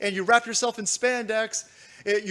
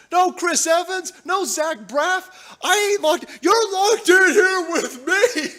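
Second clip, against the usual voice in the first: higher, louder, gloating taunt; taunting shout; 8 semitones higher, 5 dB louder, slower than usual. Real, not acted. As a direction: yell it at them, laughing at the end.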